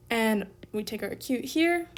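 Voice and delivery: normal talking voice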